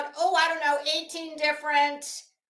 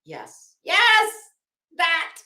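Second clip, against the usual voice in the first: excited realization; 5 semitones lower, 8 dB louder, slower than usual. Retold or imitated. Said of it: "I was like".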